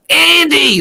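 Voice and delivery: high-pitched mock holler, stretched out; hammed-up, familiar call; imitated distant shout. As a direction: holler a mate's name across a pond. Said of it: "just shouts across the, across the pond".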